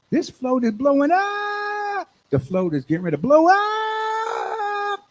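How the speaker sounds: very high, drawn-out holler; excited hype. Acted. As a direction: stretch the last word into a high wail. Hyping the crowded parade float.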